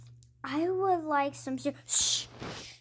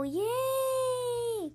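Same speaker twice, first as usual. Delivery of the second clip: excited cheer; 11 semitones higher than usual. Pretend. Child cheering about school.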